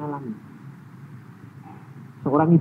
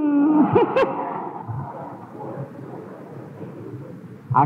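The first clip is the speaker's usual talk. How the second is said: high breathy taunting cackle; mocking laugh; 13 semitones higher than usual. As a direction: laugh at the enemy. (tawa mengejek)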